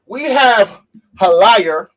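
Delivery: loud, high, hyped show opener; hype host voice. Real, not acted. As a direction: kick it back off like a hype host.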